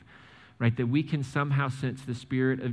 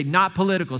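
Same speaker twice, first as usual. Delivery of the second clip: louder, higher call for the crowd to echo; call and response; 5 semitones higher, 5 dB louder than usual. Shown as a demonstration. Pastor cueing the congregation to repeat, raised call. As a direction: lead the room in saying Not political. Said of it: "Everybody say".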